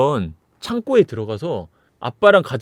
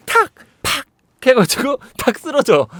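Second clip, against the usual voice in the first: normal talking voice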